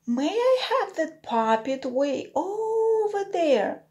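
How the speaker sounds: put-on high, bright little girl asking. A narrator's reading. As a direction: ask politely as a small girl. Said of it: "asked another girl".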